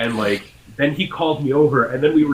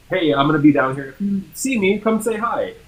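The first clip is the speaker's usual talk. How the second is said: higher, upbeat shout-out to followers; cheery invitation; 8 semitones higher, 4 dB louder than usual. Retold or imitated. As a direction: announce it brightly, pitch climbing at the end. Acting out own upbeat post.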